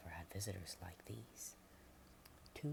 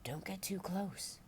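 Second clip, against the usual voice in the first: higher, louder urgent warning; warning farmer; 11 semitones higher, 5 dB louder, slower than usual. Acted. Farmer Sally warning villagers off the sick visitors. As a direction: hold them back with a sharp caution.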